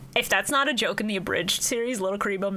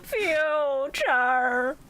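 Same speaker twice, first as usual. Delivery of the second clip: high, drawn-out dramatic cry; gasp, then stretch the word out high; Shizu having a breakdown; 6 semitones higher, much slower than usual. Retold or imitated. Seer having her nightly breakdown, hammed-up anguish. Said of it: "has a breakdown just going".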